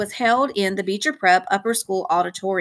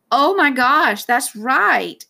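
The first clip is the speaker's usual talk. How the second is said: louder, higher exclamation; sudden delighted recollection; 5 semitones higher, 4 dB louder, much slower than usual. A narrator's reading. Dad suddenly recalls his son's old braid, exclaiming. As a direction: exclaim as a memory comes back.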